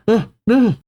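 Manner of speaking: put-on high, frightened kid yelp; acted cry of a scared boy; short high-pitched plea; child crying out. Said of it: "Cullen cries out".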